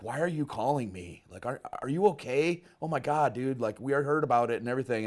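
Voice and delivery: worried surprise. Retold or imitated. Friends react worried to his call.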